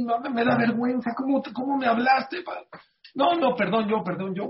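high, rushed, embarrassed woman's voice; flustered woman apologizing. Retold or imitated. The lady on the phone, embarrassed, high flustered apology.

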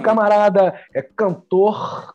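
slow, booming talk show host announcement; TV show intro. Acted. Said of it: "aquela introduçãozinha de televisão".

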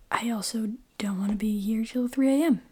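ordinary delivery

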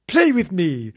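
lightly voiced eager puppy; playful dog. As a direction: beg to play like a puppy. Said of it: "she said".

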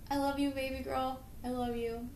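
soft, hushed loving words; tender dad. Retold or imitated. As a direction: comfort a crying nine-year-old. Dad comforting daughter.

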